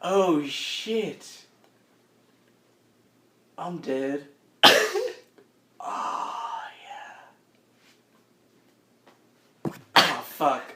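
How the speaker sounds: very soft, fading voice with long pauses; faint, halting, spent murmur; dying doppelganger. Acted. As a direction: weakly realise you are dying. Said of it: "and he's like".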